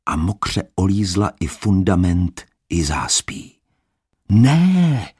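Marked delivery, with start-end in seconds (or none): 4.3-5.1 high, loud cry; shouted no. Acted. Adam calling out as water reaches the door. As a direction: cry out to stop them. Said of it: "volal Adam".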